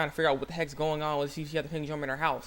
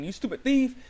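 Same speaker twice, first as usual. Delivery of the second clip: high, louder mock-outraged exclamation; retold accusation; 9 semitones higher, 5 dB louder than usual. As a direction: fling it out at a thief in mock outrage. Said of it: "she's up saying".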